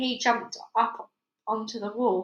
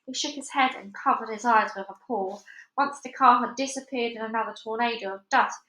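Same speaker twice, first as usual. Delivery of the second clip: plain reading voice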